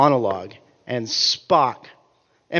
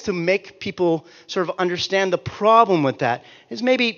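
ordinary talk

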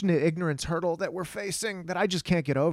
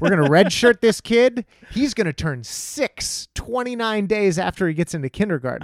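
higher, louder scheming parent; excited Texas parent; 6 semitones higher, 3 dB louder than usual. Retold or imitated. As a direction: plot it like a thrilled football dad.

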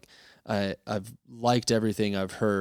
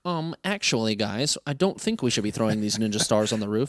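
nerd voice. Retold or imitated. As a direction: do a nitpicky nerd correcting his friends. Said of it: "they're not trying to make him sound like a nerd".

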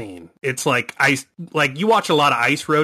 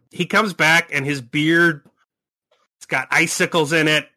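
ordinary talk, nothing marked

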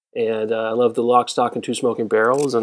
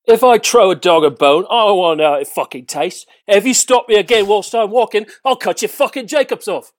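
menacing gangster; 6 semitones higher, 6 dB louder than usual. Acted. Guest delivers gangster film quote.

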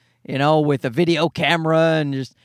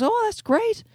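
high, put-on voice of the neighbor lady; mock neighbor voice; 14 semitones higher, 3 dB quieter than usual. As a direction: ask it like the prim upstairs neighbor. Neighbor lady's reply.

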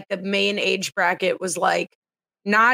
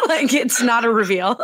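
laughing; 3 semitones higher than usual. Real, not acted. Laughing at co-host.

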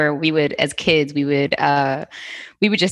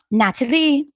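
high, sing-song Trinidadian mother; high-pitched put-on Trinidadian accent; mom's Trinidadian accent; 9 semitones higher than usual. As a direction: impersonate your Trinidadian mom gossiping. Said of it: "that's my mom talking".

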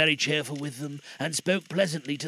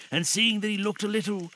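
plain reading voice

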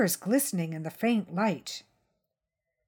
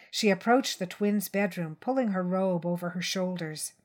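plain reading voice

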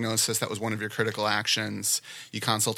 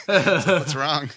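slow, laughing through the words; 5 semitones higher, 6 dB louder, much slower than usual. Real, not acted. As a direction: giggle as you ask it. Laughing about her crying baby.

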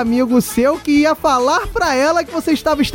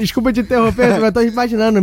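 ordinary talk, nothing marked

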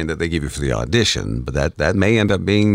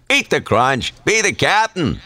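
Captain Crunch voice; 11 semitones higher, slower than usual. Shown as a demonstration. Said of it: "Slight variations".

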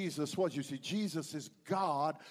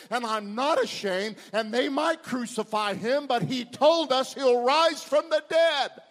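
higher pitch, building to a loud declaration; impassioned preaching; 6 semitones higher, 5 dB louder than usual. Acted. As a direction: preach it boldly, swelling to the climax. Preaches at rising force.

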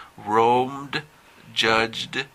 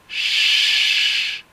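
long loud hushing sound of pure air; sustained "shh"; 4 dB louder than usual. Shown as a demonstration. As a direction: hold out a strong, voiceless "shhh".

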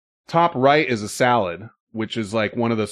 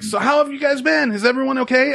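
much higher, caring imitation; Tien's voice; 13 semitones higher than usual. Bartender checks on the regulars, high caring imitation. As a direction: pitch it way up, concerned friend. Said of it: "that's what he sounds like".

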